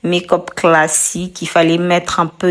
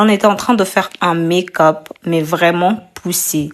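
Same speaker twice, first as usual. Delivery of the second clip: ordinary talk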